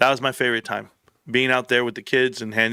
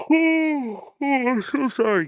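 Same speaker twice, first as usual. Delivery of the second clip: real yawn spilling into a high apology; 12 semitones higher, slower than usual. Real, not acted. Streamer apologizes to viewers mid-show, words caught in a yawn. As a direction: talk right through a big yawn. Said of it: "for yawning in front of you".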